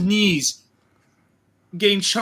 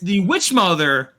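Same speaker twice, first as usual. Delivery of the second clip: usual speaking voice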